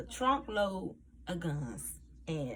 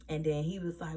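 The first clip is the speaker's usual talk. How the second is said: ordinary talk, nothing marked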